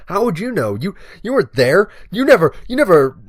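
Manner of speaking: loud, high, hammed-up defensive rant; mock outrage. Storyteller daring listeners to doubt him, mock outraged rant. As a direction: protest indignantly, building to a loud curse.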